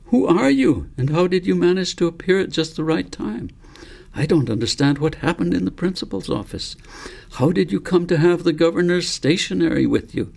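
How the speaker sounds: a hint of a puzzled youngster firing questions; young David voice. A narrator's reading.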